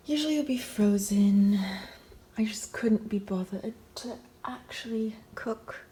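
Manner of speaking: playful put-on British accent, crisply projected. Vlogger explaining a lazy banana smoothie, mock British accent. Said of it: "doing a British accent".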